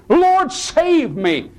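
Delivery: high, slow, pleading cry. Acted. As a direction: cry out for rescue, drawn out. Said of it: "he cried out".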